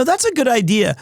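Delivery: ordinary talk, nothing marked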